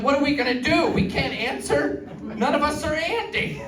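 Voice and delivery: high, mock-panicked whine. Comedian and buddy panicking over ringing phone, high mock panic.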